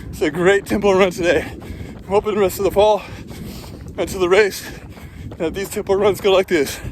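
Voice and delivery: breathless, talking mid-run; puffing between phrases on a run; while running. Real, not acted.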